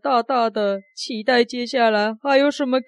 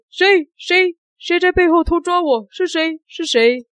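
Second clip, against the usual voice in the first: startled demand; 7 semitones higher than usual. Acted. Assistant startled by second pinch.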